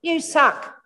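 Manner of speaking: a sneering little inner voice, lightly done; mock the doubting voice inside; inner critic voice. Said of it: "a little voice inside that says".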